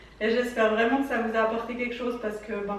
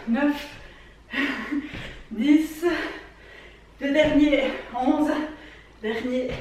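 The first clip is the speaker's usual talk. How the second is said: workout coach; 2 semitones higher than usual. Acted. Coach counting through workout.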